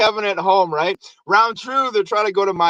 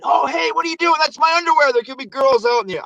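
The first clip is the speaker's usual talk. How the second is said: put-on high indignant kid voice; a boy outraged at the bellhop; retold scolding; 7 semitones higher, faster than usual. Kevin yelling at the bellhop over his underwear, indignant.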